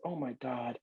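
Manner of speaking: alarmed hush at the window; hushed panic. Retold or imitated. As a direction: hiss it so he can't hear. She sees him from window.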